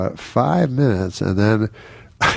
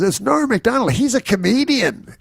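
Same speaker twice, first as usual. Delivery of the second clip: a much higher mock announcer, slowing at the name; announcing the reveal; 13 semitones higher than usual. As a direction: ham up the organizer's big unveiling. Organizer repeats the announcement.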